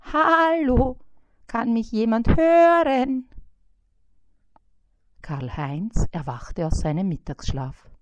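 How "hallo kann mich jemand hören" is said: high, loud little fly calling out; a tiny trapped fly crying for help. A narrator's reading. Trapped fly Bissi calls out to anyone, high and loud.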